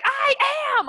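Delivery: high, loud mock-defensive retort; bickering whine. Nagged friend answering back before the call, exasperated mock yell. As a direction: protest like a nagged sibling. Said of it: "siblings who fight all the time".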